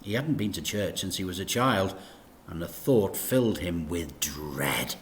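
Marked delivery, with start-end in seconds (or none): none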